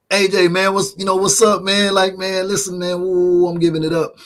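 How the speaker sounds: gushing host greeting his hero; excited greeting. Retold or imitated. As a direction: hype him up like a starstruck host. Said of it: "I'm like".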